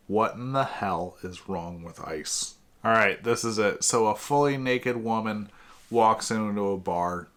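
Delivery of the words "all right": ordinary talk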